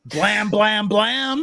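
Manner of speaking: high, playful "blam" gunshot noises; imitated gunshots. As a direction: fire off three high mock gunshots. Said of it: "What does it sound like when a gun goes off".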